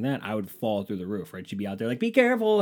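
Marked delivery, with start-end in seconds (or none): 2.0-2.6 high-pitched mock worried call; anxious warning. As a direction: imitate an anxious housemate calling out. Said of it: "somebody who has just anxiety".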